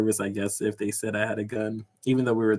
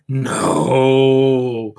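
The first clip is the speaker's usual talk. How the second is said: long, loud, drawn-out no, imitating him; reluctant denial; 2 semitones higher, 10 dB louder than usual. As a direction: stretch out a mock awkward denial. Retold waiter stalling on awkward question.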